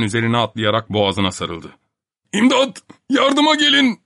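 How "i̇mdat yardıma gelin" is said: panicked high scream for help; bağırarak. A narrator's reading. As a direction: shriek it in terror. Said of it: "diye bağırmaya başladı Topak". (panik içinde çığlık)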